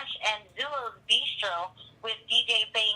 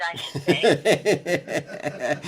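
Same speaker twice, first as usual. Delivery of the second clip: laughing; 6 semitones lower, 6 dB louder, much slower than usual. Real, not acted. Caller jokes, laughs.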